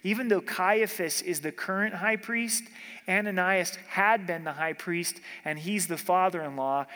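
ordinary talk

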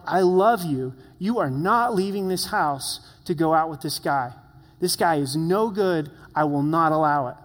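forceful fatherly refusal. Retold or imitated. Father forbidding his teenage daughter to leave, forceful and loud. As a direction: lay down the law, hitting key words hard.

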